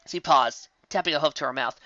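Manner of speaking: ordinary delivery, nothing marked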